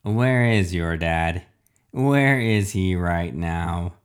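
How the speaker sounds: slow, menacing villain questioning; refrigerator villain. Acted. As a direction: draw out each question like a robot villain.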